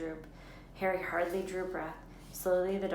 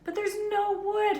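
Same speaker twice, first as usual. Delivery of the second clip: high, loud panicked cry; panic, wringing her hands; 9 dB louder than usual. A narrator's reading. Hermione cries out in panic, high loud cry. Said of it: "Hermione cried".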